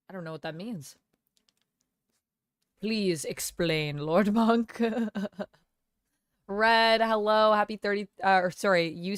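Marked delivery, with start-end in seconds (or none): none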